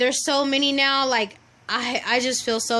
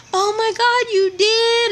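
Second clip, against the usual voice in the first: much higher, louder gasping surprise; imitating a shocked lady; 10 semitones higher, 5 dB louder than usual. Office lady hearing about the surgery, high shocked gasp. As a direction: play the office lady's shocked disbelief.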